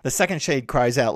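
normal talking voice

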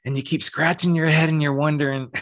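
ordinary delivery

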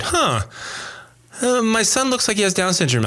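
high, puzzled voice; startled realization. Retold or imitated. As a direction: sound suddenly unsure about your son. Father retelling shock at birth.

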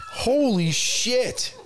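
slow high laughing holy shit; amazed. Real, not acted. Hearing he went gray at twenty, laughing disbelief. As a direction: stretch it out in disbelief.

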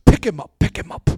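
urgently telling helpers to lift him; loud breathy repeated pick him up; preacher's command. Retold or imitated. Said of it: "then he goes".

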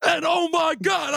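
high, loud play-by-play shout; imitated sportscast frenzy; sportscaster's excited shout. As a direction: yell it like a commentator losing it. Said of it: "The way he told that story".

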